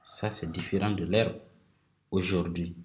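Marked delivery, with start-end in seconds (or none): none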